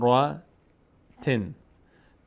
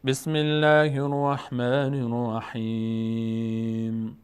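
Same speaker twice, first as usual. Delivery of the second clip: slow melodic tajweed chant; Quran recitation; 3 semitones higher, much slower than usual. Shown as a demonstration. Teacher reciting the verse for the class. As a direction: recite slowly, drawing out the vowels.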